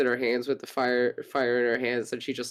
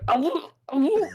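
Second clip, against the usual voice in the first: high muffled ow ow through a held beak; muffled cries; 14 semitones higher, 3 dB louder than usual. Acted. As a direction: squawk it out with the beak held shut. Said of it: "Muffled, muffled".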